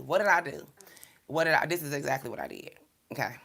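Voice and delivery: ordinary talk, nothing marked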